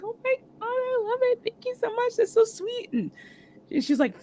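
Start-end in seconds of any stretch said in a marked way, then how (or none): 0.0-2.9 very high, light gush; freaking out happily. Retold or imitated. Star receiving a book of fan messages, freaking out. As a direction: imitate a star overcome with delight. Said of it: "freaking out".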